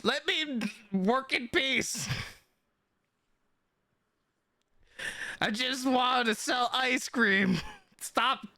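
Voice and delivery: high, fist-shaking shopkeeper yelling; sputtering foiled villain. Acted. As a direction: shake your fist and sputter at them. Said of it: "he's doing that thing where he's, like, shaking his fist".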